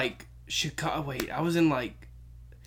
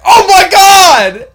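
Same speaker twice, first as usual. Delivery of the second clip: high, drawn-out mock scream; horrified onlookers' shout; 12 semitones higher, 17 dB louder, much slower than usual. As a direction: shout it out like a shocked crowd.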